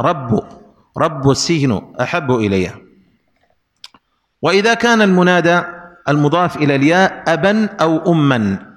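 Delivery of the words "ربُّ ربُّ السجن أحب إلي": "رب" said rabbu; variant with damma. Shown as a demonstration. Teacher contrasting the other reading, "رب" said rabbu. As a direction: put an u on the end of "رب". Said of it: "لكن جاءت قراءة".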